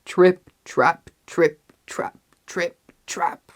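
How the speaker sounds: loud, heavy footsteps; heavy stomping. A narrator's reading. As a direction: stomp the words out. Heavy goat on the bridge.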